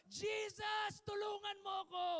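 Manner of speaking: loud, high cry for help; shouted plea. Shown as a demonstration. Pastor showing congregation how to shout to Jesus, loud high plea. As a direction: shout it out as you want them to. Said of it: "Tumawag sa Kanyang pangalan at sumigaw".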